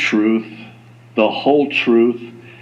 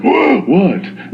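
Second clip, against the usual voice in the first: higher, startled reaction; mock shock; 7 semitones higher than usual. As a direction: imitate a taken-aback listener. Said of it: "you're like".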